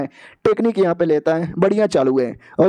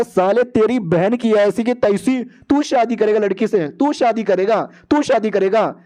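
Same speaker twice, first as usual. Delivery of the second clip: high, slow mock-angry tirade; angry confrontation; 4 semitones higher, slower than usual. Man confronting a rival over marrying the girl, angry. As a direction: drag out each accusation, pitched up.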